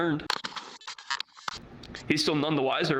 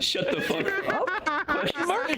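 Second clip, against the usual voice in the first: much higher rising question tune; mock questioning. Real, not acted. Joking back after friends tease, high and questioning. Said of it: "That sounded like a question".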